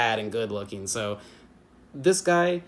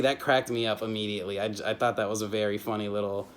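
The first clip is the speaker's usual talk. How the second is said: ordinary talk, nothing marked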